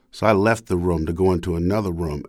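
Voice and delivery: normal talking voice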